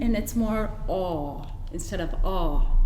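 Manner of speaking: rounded aw, then open ah, lips pushed in; aw versus ah. Shown as a demonstration. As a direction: round "aw" against a plain "ah". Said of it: "push the sides of your lips in".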